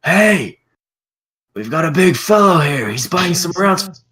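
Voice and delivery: loud, high holler across the tavern; screaming to the others. Acted. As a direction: call out to the whole crowd at full voice. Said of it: "he just screams to the others".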